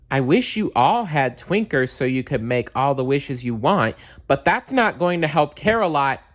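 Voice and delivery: pleading and climbing in pitch. Acted. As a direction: try to talk sense into them. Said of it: "began Wish Bear".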